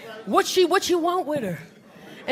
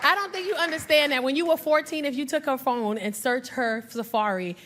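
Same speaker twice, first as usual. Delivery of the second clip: ordinary talk, nothing marked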